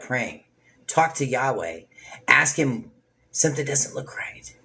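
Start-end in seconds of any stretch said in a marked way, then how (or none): 3.3-4.5 hushed, voiceless whisper of a worried prayer. Acted. A believer whispering a doubtful prayer to Yahweh. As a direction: breathe it like a secret plea to God.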